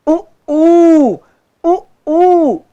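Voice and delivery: उ clipped short, ऊ held long and high. Shown as a demonstration. Teacher contrasting short and long vowels, high and loud. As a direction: contrast उ and ऊ by length. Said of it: "उ छोटा है, ऊ बड़ा है".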